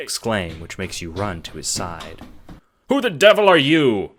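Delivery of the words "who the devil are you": loud, high gruff demand. A narrator's reading. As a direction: bark the question at the stranger. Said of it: "he demands".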